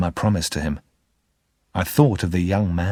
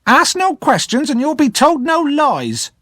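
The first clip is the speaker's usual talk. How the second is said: high, loud snap of a cross woman; snapping reply; 4 dB louder than usual. Acted. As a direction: cut him off sharply. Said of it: "my sister snapped in reply".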